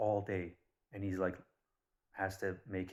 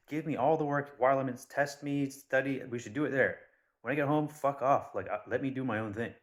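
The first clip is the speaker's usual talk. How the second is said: loud, higher-pitched retold student rant; exasperated rant; 5 semitones higher, 7 dB louder than usual. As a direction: vent it loudly, acting out the fed-up student. Acting out student's rant at school.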